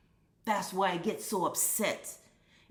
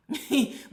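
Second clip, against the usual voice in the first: breathy laugh; 9 semitones higher, 3 dB louder than usual. Real, not acted. Laughing at own example.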